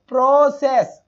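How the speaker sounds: प्रोसेस said with the wrong vowel, slow and stressed; a teacher showing a wrong sound. Shown as a demonstration. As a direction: drag out the wrong प्रोसेस as a bad example. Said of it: "ये तो answer होगा ही नहीं".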